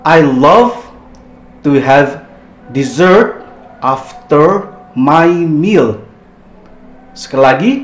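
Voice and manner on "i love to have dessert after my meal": slow, careful reading for the class to repeat; slow model sentence. Shown as a demonstration. Teacher reads the example slowly for students. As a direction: read dessert after my meal slowly.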